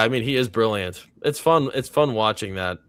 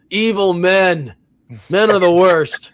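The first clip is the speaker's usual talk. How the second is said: hammed-up slow, higher, louder rant against men; mock outrage; 7 semitones higher, 8 dB louder than usual. Jokingly ranting at chat about evil men, hammed-up outrage. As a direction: play up a fake furious denunciation.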